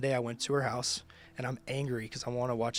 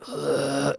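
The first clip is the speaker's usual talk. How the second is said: loud guttural retch; retching groan; 2 semitones higher, 10 dB louder than usual. Retold or imitated. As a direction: heave out a long "ugh" like throwing up. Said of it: "I'm literally going".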